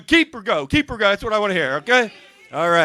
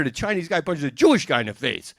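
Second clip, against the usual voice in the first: ordinary talk, nothing marked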